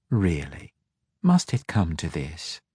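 put-on a bored child sighing at a grown-up; petulant sigh. A narrator's reading. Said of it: "as petulantly as she could manage".

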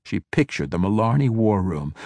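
plain reading voice

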